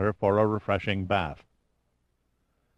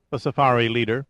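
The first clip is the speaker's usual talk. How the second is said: usual speaking voice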